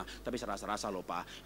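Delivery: low, soft insisting wife; wife quietly insisting; wife's voice. Acted. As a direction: murmur your feeling to him. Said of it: "husband thinks, wife feels".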